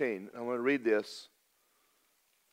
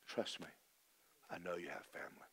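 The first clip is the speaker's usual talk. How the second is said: very soft, lower, tender reassurance; gentle; 7 semitones lower, 18 dB quieter than usual. Retold or imitated. God answering the weeping preacher, very soft and gentle. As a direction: speak it gently, like a father comforting. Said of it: "he said these words real gently".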